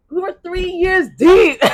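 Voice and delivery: high, loud and slow in disbelief; incredulous. Real, not acted. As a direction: drag it out, still amazed. Laughing disbelief.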